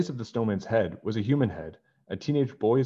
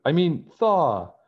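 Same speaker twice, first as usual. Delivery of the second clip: slow, much higher and louder playful yell; jokey shout; 9 semitones higher, 7 dB louder, slower than usual. Acted. George punning loudly at the fleeing snowman. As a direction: shout the pun for laughs.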